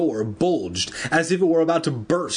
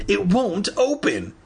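crying out; 8 semitones higher than usual. A narrator's reading. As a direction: cry it out in mild desperation. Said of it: "he cried".

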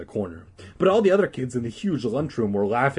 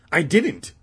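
high, loud denial; protesting; 7 semitones higher, 5 dB louder than usual. Acted. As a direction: defend yourself like an accused kid. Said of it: "Danny protested".

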